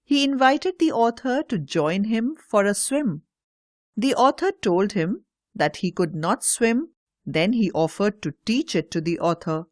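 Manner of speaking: ordinary delivery, nothing marked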